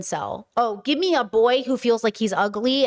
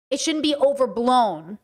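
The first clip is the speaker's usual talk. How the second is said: usual speaking voice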